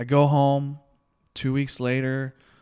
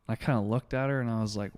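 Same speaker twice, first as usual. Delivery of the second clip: ordinary talk, nothing marked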